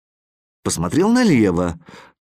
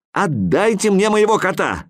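demanding; 9 semitones higher than usual. A narrator's reading. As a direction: demand the cat back. Boy demands his cat.

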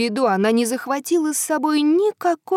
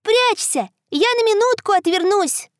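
put-on little girl voice; 8 semitones higher than usual. Girl calls to moon.

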